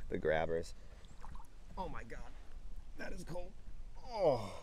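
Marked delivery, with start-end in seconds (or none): 1.8-4.5 high, breathy, hushed gasp; shocked by cold. Real, not acted. Arm plunged into freezing creek water, high hushed gasp.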